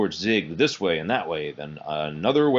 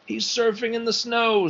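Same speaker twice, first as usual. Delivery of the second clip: put-on excited boy. A narrator's reading. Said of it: "said a boy".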